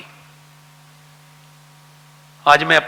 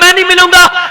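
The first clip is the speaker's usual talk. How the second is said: loud, high mock yell; stubborn refusal; 11 semitones higher, 9 dB louder than usual. Preacher voicing the angry father at the door, mock yell. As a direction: fling the refusal back at him.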